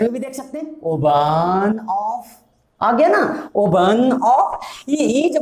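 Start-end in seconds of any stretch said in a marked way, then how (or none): 0.8-2.4 very slow, word by word, as if dictating; slow dictation. Shown as a demonstration. Teacher to class, dictating the answer very slowly. 3.6-4.6 very slow, word by word, as if dictating; slow dictation. Shown as a demonstration. Teacher to class, dictating the answer very slowly.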